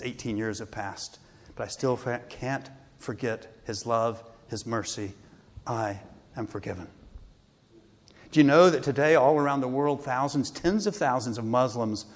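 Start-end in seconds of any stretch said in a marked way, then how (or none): 0.0-6.9 tender recovered voice. Retold or imitated. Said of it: "he begins weeping".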